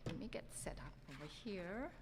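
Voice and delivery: whispered aside to herself; whispering. Real, not acted. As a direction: whisper while setting up. Speaker muttering while setting up.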